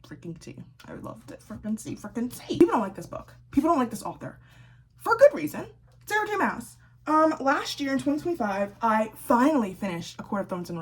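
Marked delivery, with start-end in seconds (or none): 5.0-5.7 snarky jab. Real, not acted.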